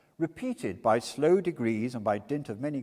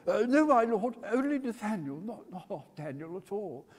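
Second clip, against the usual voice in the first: higher, flustered answer; startled timid reply; nervous young Winkle; 9 semitones higher than usual. A narrator's reading.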